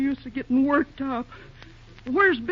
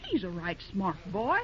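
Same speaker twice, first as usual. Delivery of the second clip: quiet musing; 7 semitones lower, 8 dB quieter than usual. Acted. Ma muses quietly about Bill.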